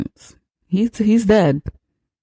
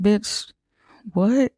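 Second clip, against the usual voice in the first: high-pitched, disbelieving reaction; incredulous; 5 semitones higher than usual. Retold or imitated. Reacting to the lady's explanation, mock incredulous. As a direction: balk at it, pitch climbing on the question. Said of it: "And it's like".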